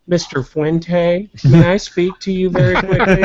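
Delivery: sweetly formal, like a proper lady; prim polite lady. Retold or imitated. Polite woman voiced in story.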